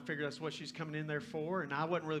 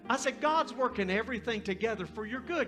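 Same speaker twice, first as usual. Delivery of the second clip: loud, raised-pitch testimony with driving emphasis; full-force preaching; 8 semitones higher, 7 dB louder than usual. Acted. Preacher telling the girl God arranged it, full-force preaching. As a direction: build it like an impassioned preacher.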